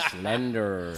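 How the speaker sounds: low, drawn-out New Jersey "slender"; learner's mock New Jersey "slender"; imitation New Jersey accent. Shown as a demonstration. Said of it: "Em has been teaching me New Jersey".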